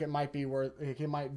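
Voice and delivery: tripping over the words; stammer, restart the phrase; stuttering. Real, not acted. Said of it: "I'm, like, stuttering".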